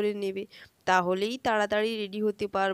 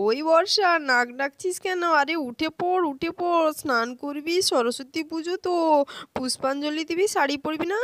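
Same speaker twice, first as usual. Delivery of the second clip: a hint of a nagging aunt, slightly higher; aunt waking children; 6 semitones higher, 4 dB louder than usual. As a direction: coax sleeping children out of bed. (ঘুম ভাঙাতে কাকিমার তাগাদা)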